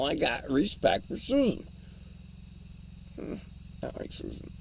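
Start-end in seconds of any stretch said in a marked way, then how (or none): 3.2-4.5 whispered aside. Real, not acted. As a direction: breathe it out unvoiced. Muttered aside about Susan.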